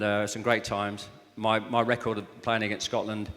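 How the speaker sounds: ordinary talk, nothing marked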